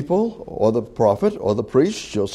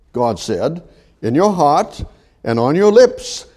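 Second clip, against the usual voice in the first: voicing God; 6 semitones higher than usual.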